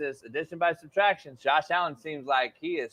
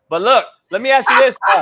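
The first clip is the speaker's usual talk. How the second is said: booming, louder interruption to grab the floor; loud cut-in; 8 semitones higher, 12 dB louder than usual. Real, not acted. Host barging in on his co-host with a question, loud. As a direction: push over the others, much louder.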